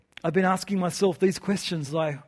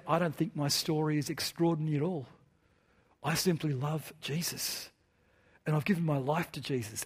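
softer, quietly retold nervous young man; 3 semitones lower, 8 dB quieter than usual. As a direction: say it quietly, a little shy, before a huge crowd. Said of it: "nervously, quietly".

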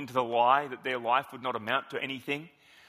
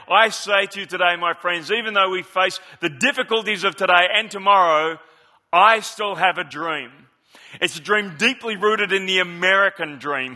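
louder, ringing preacher's cadence; Martin Luther King oratory; 2 semitones higher, 7 dB louder than usual. Acted. A pastor voicing the famous speech to his listeners, ringing oratory. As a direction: declaim it from the pulpit. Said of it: "a Baptist pastor doing this speech".

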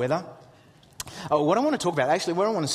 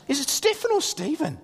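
put-on high, curious kid voice; questioning son; 10 semitones higher than usual. Son asks Dad about a name, high kid imitation. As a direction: imitate a boy asking, pitched well up. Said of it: "He goes".

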